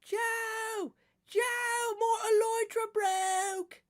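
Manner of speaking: very high put-on voice calling for help, slowing on the last words; mock panic in a squeaky voice; bad Ollie impression. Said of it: "I can't, I can't do it".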